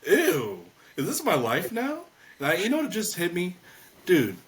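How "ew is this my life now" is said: disgusted. Retold or imitated. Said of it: "I'm like".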